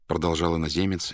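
ordinary delivery

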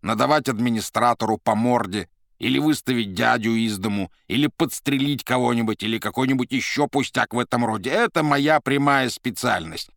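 громко и повышенным тоном, теряя терпение; громкая досада уставшего уговаривать посланца; раздражённые уговоры. Acted. Азазелло раздражённо уламывает Маргариту. (раздражённо, с досадой)

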